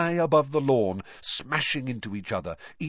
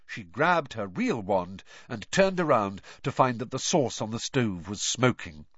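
plain reading voice

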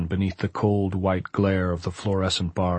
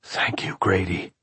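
whisper. A narrator's reading. Freed man thanks the unseen caretaker, whispering. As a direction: whisper your thanks, barely voiced. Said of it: "He whispered".

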